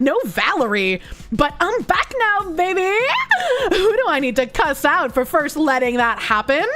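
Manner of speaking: put-on indignant Valley girl with swooping pitch; Valley girl calling someone out. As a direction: put on a sassy Valley girl, stretching key words. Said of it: "[name]'s Valley Girl voice".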